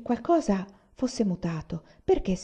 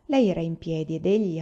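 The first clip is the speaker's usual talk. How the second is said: ordinary delivery, nothing marked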